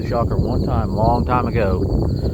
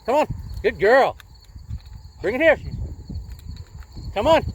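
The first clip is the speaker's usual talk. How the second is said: calling the dog; 12 semitones higher, 4 dB quieter than usual. Real, not acted.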